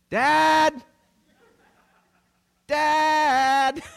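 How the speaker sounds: high, loud yell across the yard; calling for dad. Retold or imitated. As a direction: shout for dad to come save you. Kids calling father for help.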